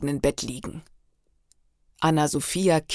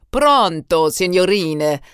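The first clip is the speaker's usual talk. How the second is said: lachender italienischer Eismann; 2 semitones higher, 7 dB louder, slower than usual. A narrator's reading. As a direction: call it out like a jovial Italian vendor. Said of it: "lachte der italienische Eismann". (überschwänglicher italienischer Eisverkäufer, laut)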